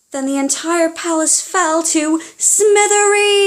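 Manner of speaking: storyteller gasping at the climax; dramatic gasp. A narrator's reading. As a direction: gasp and announce the collapse.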